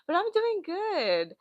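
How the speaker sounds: high, slow sing-song "doing good". Real, not acted. Greeting chat in a high slow sing-song.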